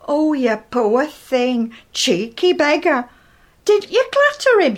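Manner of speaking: slow sympathy rising to a high indignant cry; outraged neighbour. Retold or imitated. Missus Smith on the bus reacting to Edith's story, slow sympathy rising to outrage. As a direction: fuss over her, then demand.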